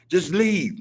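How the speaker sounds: high mock voice of officials brushing them off. Imitating city officials telling them to leave, mock voice. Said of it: "They be quick to say".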